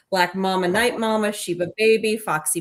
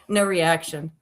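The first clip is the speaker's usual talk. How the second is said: ordinary talk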